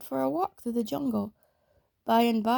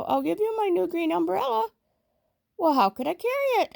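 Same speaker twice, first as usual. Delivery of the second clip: story voices; 9 semitones higher, 3 dB louder than usual. A narrator's reading. Sambo then tiger.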